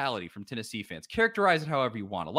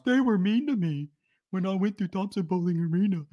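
higher whiny mock voice; mock whiny complaint; 5 semitones higher than usual. Host mocks Auburn fans complaining about Tennessee, whiny. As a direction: whimper it like a sore fan.

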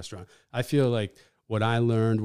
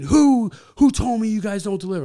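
loud, high-pitched irate customer; huffing angry customer; 12 semitones higher, 12 dB louder than usual. Retold or imitated. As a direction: storm in yelling at the staff. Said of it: "huffing and puffing".

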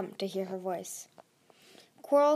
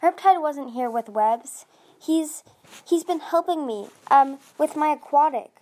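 higher, louder, halting explanation; desperate; 6 semitones higher, 8 dB louder than usual. A narrator's reading. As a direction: scramble for a believable excuse. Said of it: "Tsunami said desperately".

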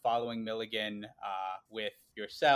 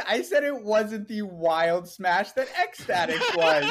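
laughing defense; 9 semitones higher, 9 dB louder, slower than usual. Real, not acted.

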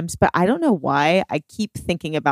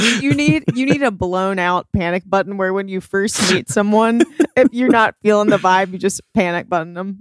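usual speaking voice

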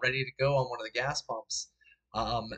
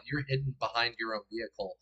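normal talking voice

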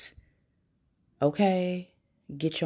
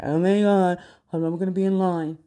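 mock whiny complainer, louder; klagende klant; 3 semitones higher, 6 dB louder than usual. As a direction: imitate someone griping in the checkout line. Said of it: "they'll sit here like".

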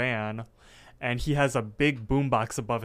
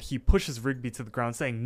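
ordinary talk, nothing marked

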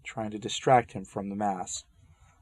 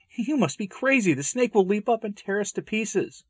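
panicked protest; 12 semitones higher than usual. Acted. Panicked refusal of rope plan.

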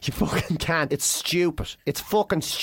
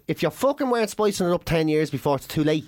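usual speaking voice

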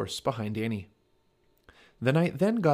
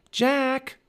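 high, loud call of a name; drawn-out, high-pitched shout; 6 dB louder than usual. A narrator's reading. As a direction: call down from above. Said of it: "Annie was calling from above".

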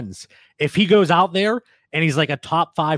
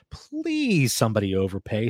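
mock plea; 5 semitones lower, 4 dB quieter than usual. Host pleading with league-mates for a trade, mock begging. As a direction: beg half-jokingly, stretching the please.

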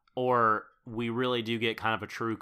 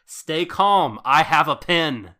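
high, loud mock heroic proclamation; all caps shout; 6 semitones higher, 12 dB louder, slower than usual. Quoting the sister's line, loud mock heroic shout. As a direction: bellow it like the notes' all caps. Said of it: "which is in all caps".

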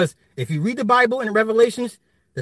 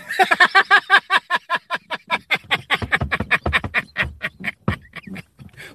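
cracking up at his own joke; big laugh; 10 semitones higher than usual. Real, not acted. Laughing hard at his own killing-spree joke.

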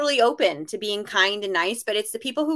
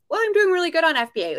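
higher, louder, quick smug excuse; mock seller excuse; 8 semitones higher, 4 dB louder, faster than usual. Imitating a seller's smug excuse, quick and higher. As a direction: play the seller who thinks he's fine.

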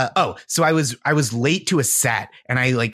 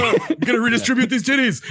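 mock Bernie fan; 10 semitones higher than usual. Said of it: "and he's like".

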